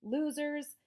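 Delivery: high, loud jeer; shout. A narrator's reading. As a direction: yell the insult into the dark. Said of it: "Henry shouted really loudly".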